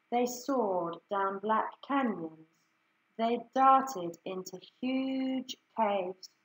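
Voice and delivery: ordinary delivery, nothing marked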